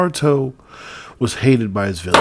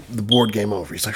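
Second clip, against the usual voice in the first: ordinary talk, nothing marked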